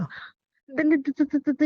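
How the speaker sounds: imitating a stutter on "तो"; mock stammer. Wife mimicking her husband's stumbling tongue, mock stammer. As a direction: parrot his stuck "तो" back at him in quick repeats. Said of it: "ये तुम्हारी जुबान क्यों ऐसे हो रही है अजीब".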